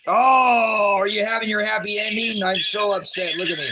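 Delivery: high, loud, hammed-up sarcastic shouting; mock anger. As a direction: ham up fake rage to mock the officers. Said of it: "Look at how mad and angry I am".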